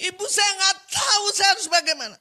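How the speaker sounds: loud, high, rushed wailing; acted frantic crying rant; imitated tearful outburst. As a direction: pour out despair as a weeping mother. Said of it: "sambil nangis".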